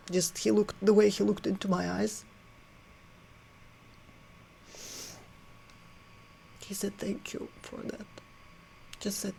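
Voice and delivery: barely holding back crying while remembering; tearful. Real, not acted. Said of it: "I thought I'll be able to talk more calmly".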